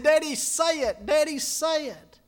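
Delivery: put-on a little boy begging his daddy; pleading child. Dying boy urges his father.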